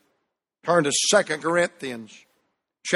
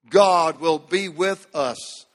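ordinary delivery

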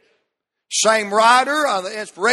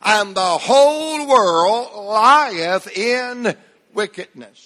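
slow, drawn-out recital with a dropping end; preacher leading a slow group reading; slow congregational recitation; slower than usual. Shown as a demonstration. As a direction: lead the room word by word, slowly. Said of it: "Let's say it one more time".

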